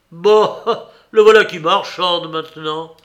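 un rire moqueur puis plus fort; dédain comique. A narrator's reading. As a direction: ricane de son marchandage. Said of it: "avec un geste de dédain comique". (dédain comique, avec un petit rire)

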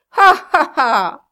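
high, loud open-mouthed guffaw; loud laugh. A narrator's reading. Kit bursts into a loud laugh after boasting.

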